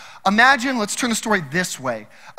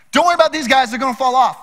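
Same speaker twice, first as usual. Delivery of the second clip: emphatic mock reassurance; 6 semitones higher, 7 dB louder, faster than usual. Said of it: "his approach".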